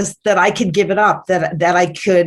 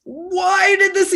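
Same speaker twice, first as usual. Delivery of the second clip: mock anguish to God; 12 semitones higher, slower than usual. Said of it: "I'm mad at God".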